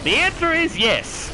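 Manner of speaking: very high, slow mock Aussie-Southern drawl; Australian-Southern accent. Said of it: "trying to do an Australian or a Southern accent".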